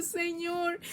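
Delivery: a high anguished cry of the Lord's name; high desperate call. Retold or imitated. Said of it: "y yo". (llamado agudo y angustiado)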